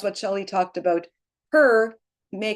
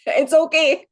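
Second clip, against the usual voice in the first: higher and louder, laughing through "It's okay"; 8 semitones higher, 5 dB louder than usual. Real, not acted. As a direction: laugh warmly at your own stumble. Laughs at her own stutter.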